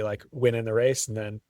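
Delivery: ordinary talk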